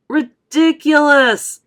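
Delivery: loud, high, drawn-out shout; slow scornful shout. A narrator's reading. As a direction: shout it down as nonsense. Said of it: "they shouted".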